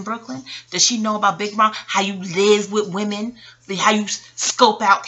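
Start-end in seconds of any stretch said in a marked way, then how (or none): none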